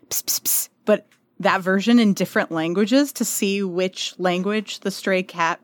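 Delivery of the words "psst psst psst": soft unvoiced psst psst psst; whispered psst calls. Shown as a demonstration. Showing how the page calls stray cats, whispered psst psst. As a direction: call a stray cat in a whisper. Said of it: "will basically do, like".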